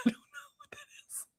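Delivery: whispered through a laugh; giggly whisper. Real, not acted. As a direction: whisper it, amused and stumped. Puzzled by emoji, laughing.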